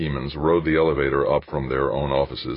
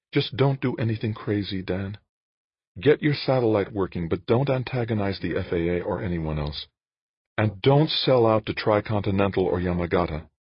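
higher woman's voice, cautioning; Jane, cautioning; 5 semitones higher than usual. A narrator's reading. Jane warns Dan before parting, higher cautioning voice. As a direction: voice the woman higher as she urges restraint. Narrator voices Jane's dialogue.

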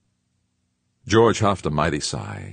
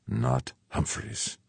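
higher, slow, softer grim refusal; growled; 9 semitones higher, 5 dB quieter, slower than usual. A narrator's reading. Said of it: "Fuchs growled".

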